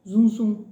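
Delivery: buzzed "zum, zum"; little bee buzz. Shown as a demonstration. Teacher likening the sound to a little bee, buzzing zum zum. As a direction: imitate a bee with a voiced Z. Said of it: "da abelhinha".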